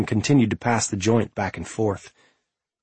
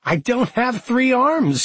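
high cackling laugh through words; 3 dB louder, slower than usual. Acted. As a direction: cackle it out, much higher and slow. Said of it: "she cackled".

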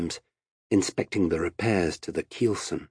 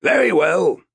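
loud, high agreement; called-out reply; 10 semitones higher, 7 dB louder than usual. A narrator's reading. Captain answering before going down to the sailors, called out. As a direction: call it out firmly.

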